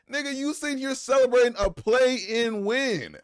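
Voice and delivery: slow, loud disbelieving rant; exasperated outburst. Real, not acted. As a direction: drag it out in disbelief. Exasperated rant at Pat Bev.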